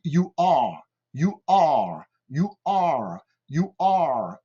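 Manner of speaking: "you are" with a long, untrilled English R on "are"; English R demo. Shown as a demonstration. Teacher drilling French beginners, drawn-out English R in "you are". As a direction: model "you are" carefully, drawing out "are". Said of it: "Donc, lorsque je dis".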